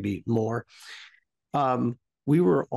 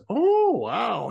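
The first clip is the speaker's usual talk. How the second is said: mock delighted wow; 5 semitones higher than usual. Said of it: "and it's just".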